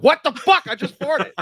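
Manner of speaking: high, loud hammed-up shock; mock startled outburst. Parodying the outburst over his own fart, mock alarm. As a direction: yelp it in comic alarm.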